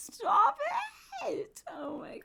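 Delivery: loud high squeal dropping to a whisper; squeal, then run out of voice; overwhelmed gushing. Real, not acted. Player overcome by her cute puppy, squeal collapsing to whisper.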